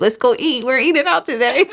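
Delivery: rally your friends, giddy; gleeful retold cheer that ends laughing; excited celebration. Retold celebration over a tip.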